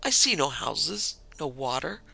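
ordinary delivery, nothing marked

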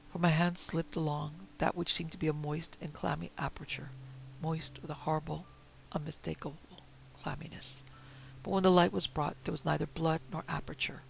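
usual speaking voice

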